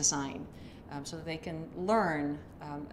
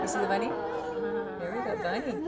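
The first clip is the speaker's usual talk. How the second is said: put-on talking to a toddler; 9 semitones higher than usual. Shown as a demonstration. Puppet peekaboo demo for baby.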